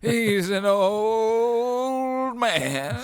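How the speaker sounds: put-on slow old man voice. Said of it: "This is a new character now".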